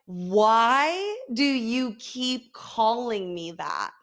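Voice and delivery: drawn-out exasperated plea, half laughing; slow, hammed-up fed-up mom; mock exasperation. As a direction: stretch every word in comic disbelief. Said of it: "I look at him and I'm like".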